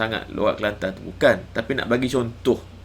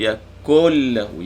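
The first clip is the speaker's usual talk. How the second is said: Quran recitation; 5 dB louder, much slower than usual. Shown as a demonstration. Teacher reciting a verse for students to follow, Quran chant. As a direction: recite yashfa' stretching the shin. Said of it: "Yaku jangan sebut".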